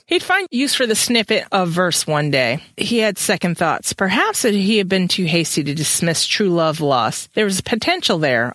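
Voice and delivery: steady storytelling read-aloud voice; story narration. A narrator's reading. Reads the book aloud.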